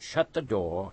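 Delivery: ordinary delivery, nothing marked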